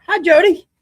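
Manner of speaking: pet talk. Real, not acted. Greeting a pet in high voice.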